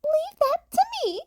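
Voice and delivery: high, chirpy small-animal voice; a bright little principal; Nezu's voice. A narrator's reading. Nezu voiced.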